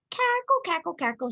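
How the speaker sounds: high clucking hen cackle; a mother hen's teasing cackle; hen clucking laugh. A narrator's reading. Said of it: "she clucked and laughed".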